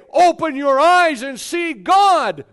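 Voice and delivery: preacher's loud slow appeal to the congregation; proclaiming. Acted. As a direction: cry it out to the whole church. Preacher calls people to see.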